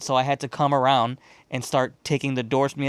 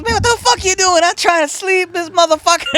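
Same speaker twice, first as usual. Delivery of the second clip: high, loud put-on voice of a startled squatter; much higher, louder annoyed man; 7 dB louder than usual. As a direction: play the woken-up guy yelling up the stairs. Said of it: "He goes".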